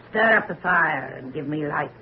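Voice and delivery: ordinary delivery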